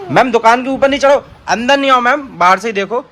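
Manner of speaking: high, loud calling out to stop her. Real, not acted. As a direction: shout to keep her off the counter. Scolding a child in a shop game.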